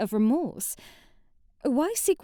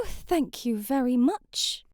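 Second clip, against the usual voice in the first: ordinary delivery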